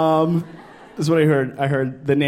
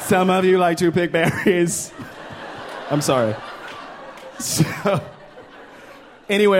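ordinary delivery

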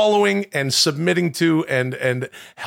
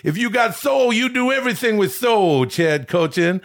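Dusty Rhodes impression. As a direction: preach soul like an old wrestling star. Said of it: "another wrestling impression".

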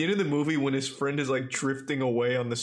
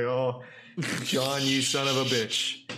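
slow, hammed-up hero reunion line; mock tearful movie hero; much slower than usual. Retelling the cheesy ending, a slow hammed-up hero line. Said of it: "He's like".

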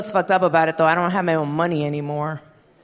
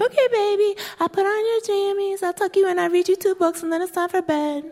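put-on much higher, singsong toddler voice; a small daughter mothering her doll, sweet and high; little girl voice. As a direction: play the tiny mom tucking in her doll. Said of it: "So she's like".

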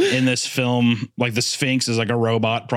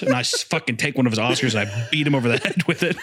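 ordinary talk

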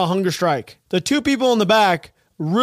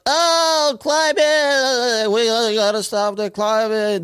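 higher, whiny mock activist; hammed-up mock chant about the climate; mocking protester voice; 3 semitones higher, much slower than usual. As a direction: make fun of a clueless protester.

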